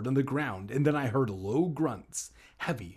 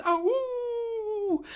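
high howling animal cry; wolf voice. Acted. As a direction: yelp like a wolf. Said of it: "uttering such frightening animal cries".

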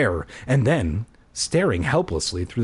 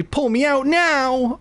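high, drawn-out plea; desperate pleading; 14 semitones higher, 3 dB louder than usual. A narrator's reading. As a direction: beg desperately, stretching the last word. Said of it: "I pleaded".

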